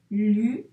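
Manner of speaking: "Lune" as loud lu, pause, hummed ne; emphatic dictation "Lune" with a gap; syllables separated. Shown as a demonstration. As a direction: break "Lune" into two syllables, lu... nne. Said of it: "la première syllabe, puis la deuxième".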